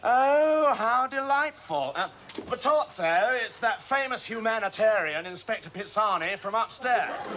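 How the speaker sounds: high, loud, effusive welcome; gushing mock delight. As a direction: announce the caller with exaggerated delight. Mock delight at phone caller, announcing him.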